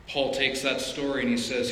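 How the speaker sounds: usual speaking voice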